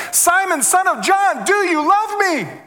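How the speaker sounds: high, slow, pleading cry; pleading Jesus. Acted. Jesus pleading with Peter at breakfast, high and slow. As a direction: ache through the question, stretched out.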